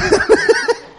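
high, loud burst of laughter. Real, not acted. Preacher joking with the congregation, laughing out loud. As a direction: laugh hard at your own joke. Laughs at own church joke.

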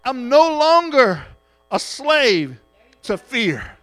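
loud, slow declaration; preaching at full force. Real, not acted. Preacher proclaiming freedom from fear to the church. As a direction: proclaim it, each phrase hammered out.

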